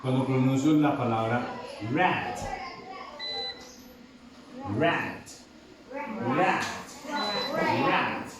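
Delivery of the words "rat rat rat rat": rat with a wide open ae; open-mouth rat. Shown as a demonstration. Teacher modelling rat for the class, mouth wide open. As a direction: drop the jaw on rat. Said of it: "se pronuncia así con la boca abierta".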